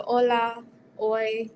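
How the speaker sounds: "hola" said slowly as "ola", no H at all; "hola" starting straight on the O; silent H. Shown as a demonstration. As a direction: drop the H in "hola" and "hoy". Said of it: "forget about the H".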